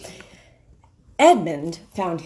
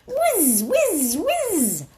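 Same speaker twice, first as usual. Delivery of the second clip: knife whizz; 12 semitones higher, much slower than usual. Acted. Imitating knife sharpening.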